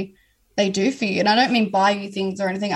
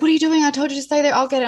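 raised, higher and louder, caring alarm; alarmed boyfriend; 7 semitones higher, 5 dB louder, much faster than usual. Retold or imitated. Boyfriend scolding her to stay in bed, loud and higher. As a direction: boyfriend catching her out of bed after surgery, calls out in alarm. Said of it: "And he'd be like".

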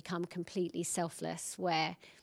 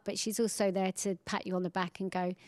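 ordinary talk

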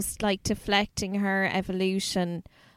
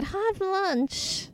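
a light, higher put-on voice for Kylie; Kylie impression; 10 semitones higher than usual. Friend mimics Kylie picking lunch, lightly higher put-on voice. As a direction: voice a coy Kylie choosing lunch. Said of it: "And Kylie would have said".

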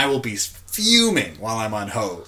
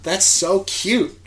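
gushing; 7 semitones higher, 6 dB louder, slower than usual. Retold or imitated. Gushing over a sweet gesture.

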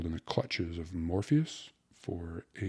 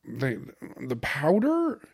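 slow, higher stammered question; taken-aback, halting query; stammering; 7 semitones higher, 6 dB louder, slower than usual. A narrator's reading. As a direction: falter on the first word, rising and loud. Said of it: "stammered Ikey".

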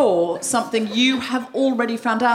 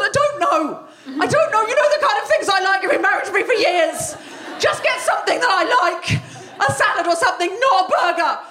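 hangry whine; 8 semitones higher than usual. Retold or imitated. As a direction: whine at him, starving and exasperated. Hangry wife pleading with husband.

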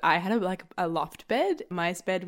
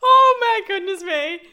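high, loud giggling words; laughing at a friend's crush; laughing hard; 11 dB louder than usual. Real, not acted. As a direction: speak through real helpless laughter.

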